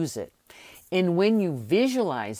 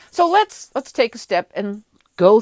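excited; 4 semitones higher, 7 dB louder than usual. Real, not acted. Pumping up the audience.

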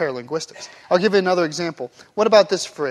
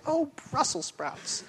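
slow prim mock swear; a sweet girl's substitute curse; girl's paper falls in the mud; goody two-shoes fake curse; 6 semitones higher, 10 dB quieter, slower than usual. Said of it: "she'd be like".

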